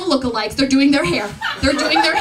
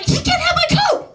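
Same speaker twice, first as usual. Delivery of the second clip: much higher, outraged protest; indignant squeal. Retold or imitated. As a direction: refuse in shocked disbelief.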